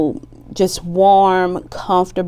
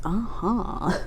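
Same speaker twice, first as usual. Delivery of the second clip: whispered uh-huh; 10 dB quieter than usual. Real, not acted. Soft aside agreeing with cards.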